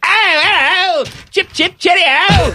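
very high-pitched cartoon English gibberish; mock English accent. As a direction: squeal out a silly caricature of English speech. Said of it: "adopt some of the mannerisms of the local culture".

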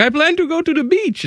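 high, thin-volume traveler impression; squeaky-high put-on tourist answer; mock foreign traveler. Voicing a traveler's answer to border officers.

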